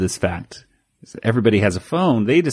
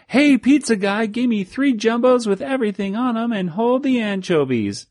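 mock yell; 12 semitones higher than usual. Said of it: "they yell out of the water tower".

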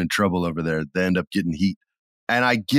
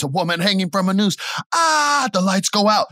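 excited retelling; 10 semitones higher than usual. Retold or imitated. Retelling scare moment.

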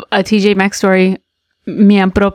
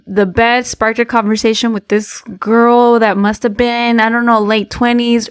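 ordinary delivery

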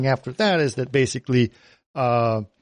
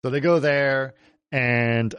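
ordinary talk, nothing marked

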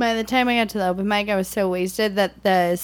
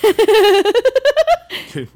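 high burst of laughter; loud laugh; 11 semitones higher, 6 dB louder than usual. Real, not acted. Laughs at diarrhea beer.

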